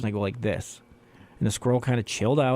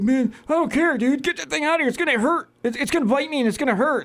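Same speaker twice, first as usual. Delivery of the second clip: high, hammed-up frightened whine; scared friend. Friend begging to get the squirrel out, high frightened whine. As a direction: whine it like a panicking little girl. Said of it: "you literally sound like a little girl".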